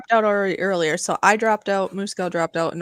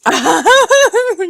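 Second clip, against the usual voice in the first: loud laugh; 12 semitones higher, 8 dB louder than usual. Real, not acted. Burst of laughter.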